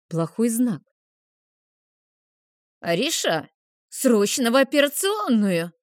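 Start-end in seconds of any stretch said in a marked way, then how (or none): 2.8-5.7 mildly alarmed summons, raised pitch; pressing, troubled call; anxious urgent call. A narrator's reading. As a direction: summon a colleague with worry in the voice. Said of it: "Тревожный голос Мейгора".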